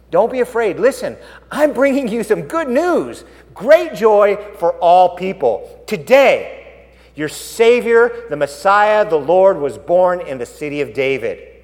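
angel's announcement. Acted. Angel to frightened shepherds in the fields, proclaiming. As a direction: proclaim it slowly and loudly as a herald. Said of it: "Then the angel said to them".

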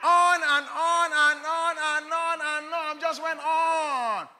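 slow, drawn-out loud shout with laughter; endless rant. Acted. Preacher mocks the man ranting on and on, slow loud shout. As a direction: stretch every on, shouting it out.

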